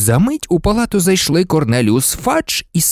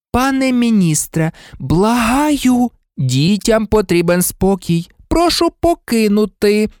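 high, fussy entreaty; beseeching matron's voice; pleading nurse; 10 semitones higher than usual. Acted. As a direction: implore them to leave the patients in peace. Nurse begs minister to leave.

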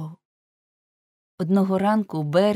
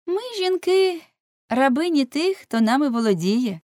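high, louder woman's voice; жіночка-співрозмовниця; 9 semitones higher, 6 dB louder than usual. Acted. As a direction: pitch it up as the woman speaking for all women. (високий, гучніший жіночий голос)

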